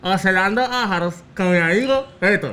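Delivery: missing labial consonants; garbled mouth-game speech. Real, not acted. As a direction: announce it without touching your lips together. Mouth game, partner guesses distorted phrase.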